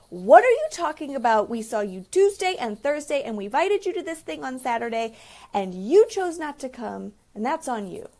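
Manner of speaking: loud, heated confrontation; angry retort. Retold or imitated. Client snapping back at her mother-in-law, loud and angry. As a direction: throw the accusations back hard.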